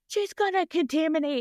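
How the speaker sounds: high, agitated mock complaint; retold exasperated yell. Surgeon yelling about the crying trainee.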